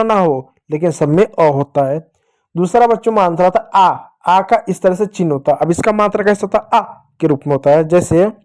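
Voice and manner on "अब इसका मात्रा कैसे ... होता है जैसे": ordinary delivery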